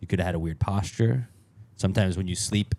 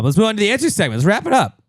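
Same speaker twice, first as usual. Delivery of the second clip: excited host; 11 semitones higher, 8 dB louder, faster than usual. Real, not acted. Show host wraps up.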